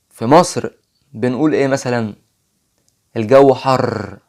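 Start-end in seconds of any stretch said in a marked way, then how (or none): 3.2-4.2 slow, the ر in حر rolled hard and long; heavy rolled r. Shown as a demonstration. Teacher demos the Egyptian rolled r, slow. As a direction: drag out حر with a strong trill. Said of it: "خدتوا بالكم ايه اللي حصل".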